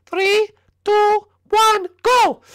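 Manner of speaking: very high cartoon announcer voice; high-pitched official countdown; squeaky announcer. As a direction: count down in a tiny, piercing voice. High figure counts down round.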